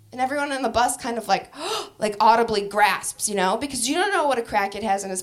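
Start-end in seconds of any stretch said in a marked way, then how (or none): none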